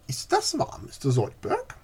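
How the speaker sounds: high, amazed question; the boss asks in astonishment; astonished. Acted. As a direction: ask in wide-eyed surprise. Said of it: "fragte die Chefin erstaunt".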